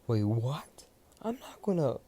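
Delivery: high, loud startled cry of wait what; shocked protest. Acted. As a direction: voice shoots way up in disbelief. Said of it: "Midoriya was saying".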